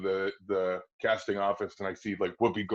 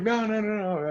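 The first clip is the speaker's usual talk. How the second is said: mock protest; 12 semitones higher than usual. Guy protesting in retold talk.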